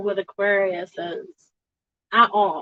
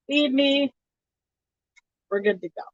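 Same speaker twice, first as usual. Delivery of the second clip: usual speaking voice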